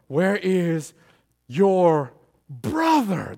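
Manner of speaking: slow question with "brother" drawn right out; leaning hard and long on "brother"; stress on brother. Shown as a demonstration. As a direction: stretch "brother" after a slow "your". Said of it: "Emphasis on brother".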